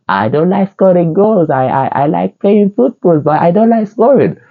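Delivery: high, put-on voice quoting the player; imitating Martinelli. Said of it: "you know when he says".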